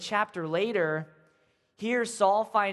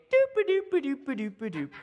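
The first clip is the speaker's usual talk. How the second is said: walking noise; 5 semitones higher than usual. Acted. Sound of walking into cave.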